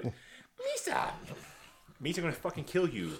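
high, goofy alien voice, hammed up; Jar Jar Binks impression. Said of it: "cannot fucking stand Jar Jar Binks".

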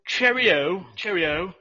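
mock British with rising lilt; British accent. Trying out the asshole Brit, mock British accent. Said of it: "the asshole British accent".

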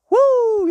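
hype woo. Real, not acted. Hype shout.

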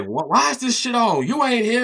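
high mock parent scolding; imitating parent. Parent scolding about the console left on, high mock outrage.

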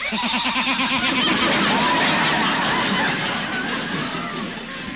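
a long wheezing laugh at his own joke; hearty chuckle. Acted. Judge laughing at Gildy's expense, hearty chuckle. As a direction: laugh it off after the tease.

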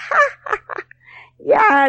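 ja ja ja, very high and teasing; high mocking laugh. A narrator's reading. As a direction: goblin laughing at the ugly face. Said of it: "se empezó a reír". (risa burlona muy aguda del duende)

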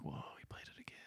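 very soft, whispered amazement; mock awed whisper. Retelling amazed onlookers.

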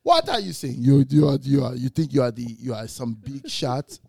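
loud, indignant father voice; loud mock outrage from dad; angry dad. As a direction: scold your son for his arrogance. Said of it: "He's like; dad angry".

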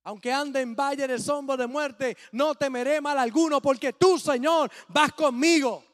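loud, ringing scripture reading; full-voiced pulpit proclamation; proclaiming the psalm. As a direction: boom it out to the whole church.

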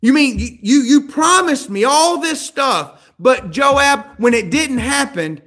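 loud, high, bitter rant; angry bitter outburst. Acted. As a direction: pour out the grievance in anger.

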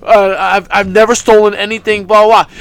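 low, quick mock quote; dismissive low-voiced imitation; mocking Taz. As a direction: rattle off the excuses in a deep mocking voice. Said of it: "After saying".

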